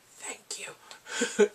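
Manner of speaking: breathy, voiceless thanks; mock relieved whisper. Train Man after she agrees to dinner, whispered thanks. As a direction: whisper it as an aside.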